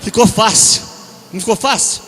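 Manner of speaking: super-homem anunciado bem alto; super-homem proclamado. Shown as a demonstration. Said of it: "Eu fiz esse teste". (super-homem gritado de brincadeira)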